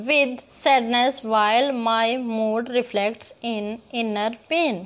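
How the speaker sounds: very slow reading, each syllable set apart; syllable-by-syllable scansion. Shown as a demonstration. Teacher scans a verse for class, slowly syllable by syllable. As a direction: chop the verse into slow separate beats. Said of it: "टुकड़े करके देखेंगे".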